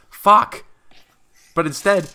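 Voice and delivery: loud, high exasperated curse; frustrated outburst. Real, not acted. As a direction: blurt it out, fed up.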